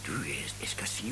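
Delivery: voiceless whispered quote. Retold or imitated. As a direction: whisper it to your friend at the concert. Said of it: "Og så visker han".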